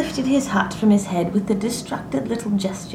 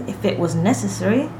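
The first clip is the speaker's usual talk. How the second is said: plain reading voice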